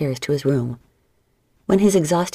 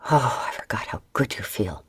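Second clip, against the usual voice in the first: hushed intimate whisper, lower; lover's low whisper; breathy whisper; 5 semitones lower, 4 dB quieter than usual. Acted. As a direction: whisper it close to her ear. Lovers in bed, Laurens groaning.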